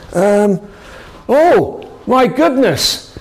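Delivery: a wide-eyed, stretched-out oh my goodness; amazed exclamation. Retold or imitated. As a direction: gasp it out as Jesus realising. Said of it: "it all starts to fire off in Jesus' head".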